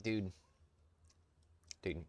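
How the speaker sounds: ordinary talk, nothing marked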